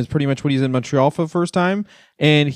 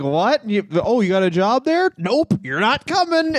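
higher, slowing to a flat refusal; mock baffled partner; 6 semitones higher than usual. Partner hearing about a Boston job, mock baffled refusal. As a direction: play the partner, baffled then curtly dismissive. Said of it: "your partner's like".

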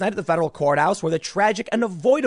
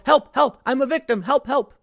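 much higher, louder whiny cry for help; mock victim; 9 semitones higher, 4 dB louder than usual. Both political sides after getting hit, whiny mock cry for help. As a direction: parody a self-pitying victim. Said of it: "they go".

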